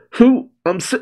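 high-pitched, stumbling startled questions; mock panicked sputtering; flustered questioning. Acting flustered Bonnie after coughing.

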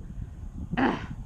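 soft rough coughs; coughing fit. Real, not acted. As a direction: cough it out quietly. Coughing on hair.

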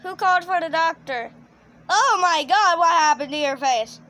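loud mock shock, peaking on the exclamation; brisk question, then a loud horrified outburst; alarmed doctor. Shown as a demonstration. Director showing the doctor seeing Jeffy's face, loud mock alarm. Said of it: "the doctor is gonna be like".

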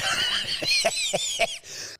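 high screeching dinosaur cry; pterodactyl shriek. Pretend. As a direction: shriek like a baby pterodactyl hatching. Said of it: "don't do your pterodactyl impression".

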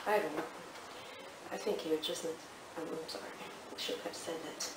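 low, fading mumble; sheepish apology. Acted. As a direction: trail into a small, low apology. Therapist backs down, apologising.

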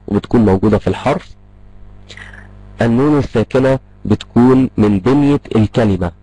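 normal talking voice

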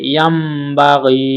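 ইয়ামবাগি with the m held and nasalised; slow ghunna on ইয়ামবাগি; nasal ghunna. Shown as a demonstration. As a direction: hold the মিম in ইয়ামবাগি through the nose, slowly. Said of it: "এই মিমটা ধরে পড়তে হবে গুণনা করে।".

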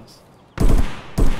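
frustrated loud. Real, not acted. Frustrated no shot.

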